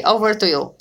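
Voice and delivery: ordinary talk, nothing marked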